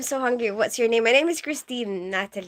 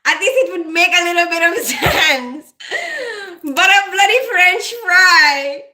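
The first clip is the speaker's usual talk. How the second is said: loud, higher, laughing and wailing; 7 semitones higher, 8 dB louder than usual. Real, not acted. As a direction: push it out through helpless laughter. Laughing at the absurd dream.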